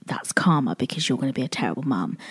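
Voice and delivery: fast, hushed whisper of a cruel thought; a quick, soft, nasty whisper; whispered inner voice. Retold or imitated. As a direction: whisper it like the mean voice in your head. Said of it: "my brain would go".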